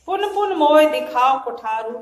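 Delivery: loud declaimed verse line; verse recitation. Shown as a demonstration. Teacher quoting Lakshman to students, loud recitation. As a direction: declaim it for the class.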